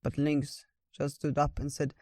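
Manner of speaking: usual speaking voice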